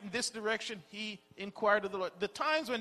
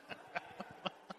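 soft breathy laugh; 12 dB quieter than usual. Real, not acted. Laughs after the texting example.

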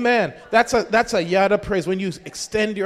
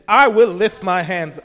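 loud, resolute vow; firm declaration; 6 dB louder than usual. Shown as a demonstration. Preacher shows the congregation true praise, firmly resolute. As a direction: declare it with full conviction. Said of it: "means it's not like".

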